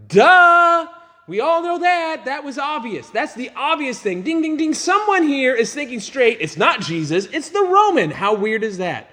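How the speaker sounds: hammed-up know-it-all crowd; sarcastic crowd. Mocking crowd voiced in story.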